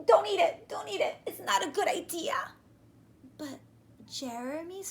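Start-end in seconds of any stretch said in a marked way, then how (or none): 0.1-2.3 high, loud desperate warning; urgent plea. Acted. Boy pleading with friend not to eat. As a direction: beg him to stop.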